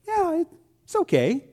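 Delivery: high-pitched, halting mock reply; hedging answer. Church member pressed about the sukiyaki, high and hedging. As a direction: hedge it lightly, pausing after the first word.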